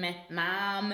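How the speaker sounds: "mom" with a nasal Michigan vowel; nasal Michigan accent. Shown as a demonstration. American demonstrating her home state, nasal Michigan accent. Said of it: "because it's so nasal".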